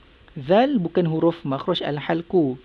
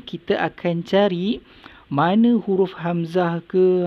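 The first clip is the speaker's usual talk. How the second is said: usual speaking voice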